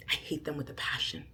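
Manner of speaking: breathy whisper, hating them; hushed passionate loathing; whispered hate. Real, not acted. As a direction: drop to a whisper with contempt. Whispered aside about hating shows.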